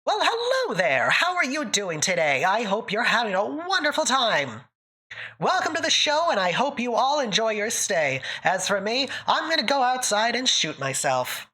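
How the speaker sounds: high, lilting mid-Atlantic announcer; mid-Atlantic accent, bright and theatrical. Shown as a demonstration. Streamer demoing a mid-Atlantic accent for chat, high theatrical lilt. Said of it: "It's very".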